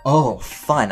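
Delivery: ordinary talk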